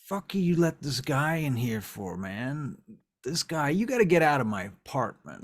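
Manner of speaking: loud, irate demand; angry protest. Acted. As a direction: kick an intruder out of your place. Angry host confronts an intruder.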